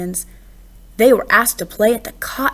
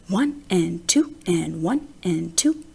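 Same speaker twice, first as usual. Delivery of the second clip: softer counting with a lift at the end; plunked-out count; 4 semitones lower, 5 dB quieter than usual. Acted. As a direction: tap the beat out as you plunk keys. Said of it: "trying to plunk out his own ragtime rhythm".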